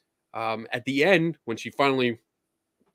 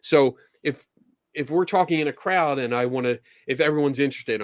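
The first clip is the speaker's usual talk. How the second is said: ordinary delivery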